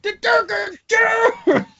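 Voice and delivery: high, slow crowd shout. Acted. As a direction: yell it from the front row.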